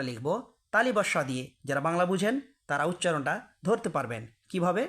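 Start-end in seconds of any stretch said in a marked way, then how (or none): none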